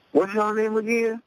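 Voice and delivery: retold star, amazed and asking the rapper's name; impressed. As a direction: sound suddenly very interested. [name] impressed on the phone.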